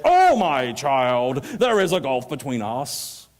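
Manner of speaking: very slow, booming reading; grand declamation. Shown as a demonstration. Reader booming Abraham's words with no anguish, slow and declamatory. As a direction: proclaim it grandly, drawing out each word. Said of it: "if Tom had read it".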